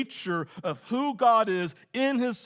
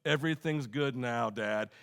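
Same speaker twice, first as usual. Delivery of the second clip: calm reassurance; 7 semitones lower, 4 dB quieter than usual. Retold or imitated. Kid reassures dad after rescue.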